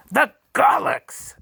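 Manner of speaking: very high, loud, slow shriek. A narrator's reading. The Duke shrieks as he realises the spy, very high and loud. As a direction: shriek the name in alarm. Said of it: "shrieked the Duke".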